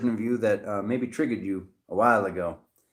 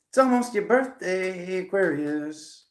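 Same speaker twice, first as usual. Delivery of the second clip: high start, stretching out the name; playful greeting, slowing at the end; drawn-out birthday tease; singsong birthday greeting; 7 semitones higher, slower than usual. Real, not acted.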